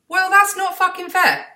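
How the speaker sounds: high, loud mock outrage; indignant outrage. Real, not acted. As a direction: protest it's unfair, half laughing. Scales show a higher weight.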